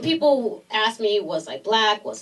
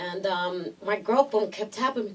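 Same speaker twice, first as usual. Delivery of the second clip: usual speaking voice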